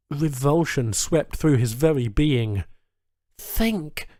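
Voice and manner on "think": higher, soft strained plea; will your mind to work; urging himself. A narrator's reading. Charles pleading with his own mind, soft and strained.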